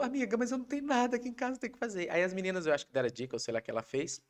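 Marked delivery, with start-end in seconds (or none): 0.0-2.1 higher, quick flustered wife voice; mimicking wife. Husband imitating his wife's panicked message to friends. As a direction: rattle it off high like her. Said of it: "E aí ela falou".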